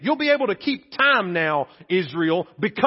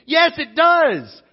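higher, louder, slow and hammered; emphatic rebuttal; 7 semitones higher, 6 dB louder, much slower than usual. Real, not acted. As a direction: slam each word down against the shrug. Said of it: "preacher rebuts the shrug".

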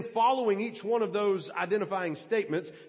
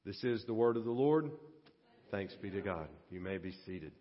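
soft, low liturgical close; quiet formal liturgy; liturgical response; 12 semitones lower, 7 dB quieter than usual. Acted. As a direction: hand the congregation their response gently. Liturgical closing after reading.

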